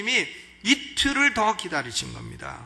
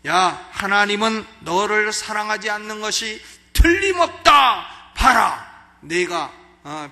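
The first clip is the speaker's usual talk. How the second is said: loud, booming devil taunting the believer; a hammed-up loud devil, not a whisper at all; 4 semitones higher, 9 dB louder than usual. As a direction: play the devil jeering loudly at a doubter. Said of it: "요렇게 마귀가 속닥거리는 거예요".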